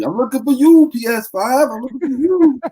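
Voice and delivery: high, loud boxer's taunt; mock Ali challenge shouted at the PS five; Muhammad Ali. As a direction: call it out like a fighter hunting his opponent. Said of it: "I feel like Muhammad Ali".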